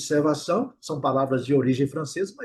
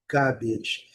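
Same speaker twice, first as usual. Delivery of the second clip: British "garbage" with prolonged A, R dropped; soft British model "garbage", silent R; 2 semitones lower, 4 dB quieter than usual. Shown as a demonstration. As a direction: say "garbage" as GAH-bidge, British style. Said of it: "sem o R".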